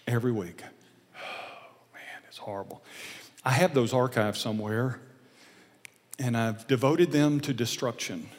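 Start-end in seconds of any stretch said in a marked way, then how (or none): 1.2-2.8 breathy, voiceless groan of embarrassment; whispered cringe. Real, not acted. Preacher recalls his taped teen lessons, whispered cringe. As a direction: whisper it as a pained aside.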